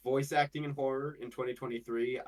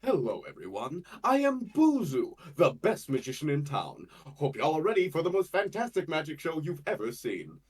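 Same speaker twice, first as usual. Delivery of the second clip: grand, higher-pitched showman magician; Buzu first pitch; 5 semitones higher, 6 dB louder than usual. Shown as a demonstration. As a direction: announce it like a stage magician opening a show. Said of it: "variations that I initially pitched as".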